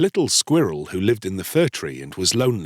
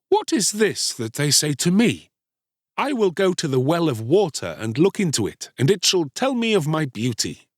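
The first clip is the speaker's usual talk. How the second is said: lightly voiced, higher boy; puzzled vain boy; 7 semitones higher than usual. A narrator's reading. Star-Child to himself about the insult, mildly puzzled. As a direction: wonder aloud, pitched up a little.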